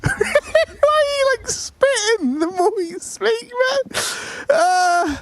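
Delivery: high, slow words squeezed out through real laughter; can barely get the question out for laughing; laughing while talking. Real, not acted. Cracking up at co-caster's accent.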